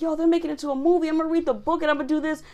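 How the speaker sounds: fast, high, excited self-quote; mock eagerness. Reader hearing of a movie version, fast high eagerness. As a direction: rattle off the big plan in a high voice.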